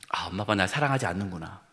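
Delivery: softly hurt, resigned realization; hurt child. Acted. Grown child realizing parents don't love them, quietly hurt. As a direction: murmur it like a disappointed child. Child's sad thought voiced.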